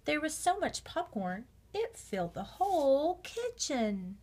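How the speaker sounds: ordinary delivery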